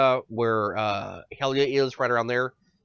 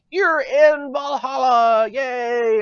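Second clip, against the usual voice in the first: mock cheer; 9 semitones higher, 7 dB louder, much slower than usual. Mock-cheering the Valhalla rescue.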